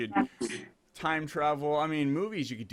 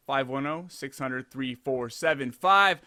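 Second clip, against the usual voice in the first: ordinary talk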